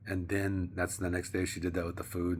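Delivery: usual speaking voice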